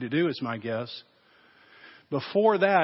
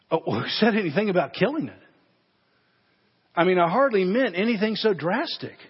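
flustered excuse; 4 semitones higher, 3 dB louder than usual. Acted. Man backpedals in alarm.

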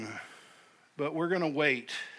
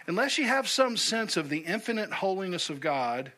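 ordinary talk, nothing marked

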